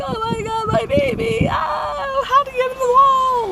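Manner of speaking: high, whiny mock-distraught cry; acted panicked wail. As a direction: wail it high, re-enacting panic over a pet. Retells panic over trapped cat.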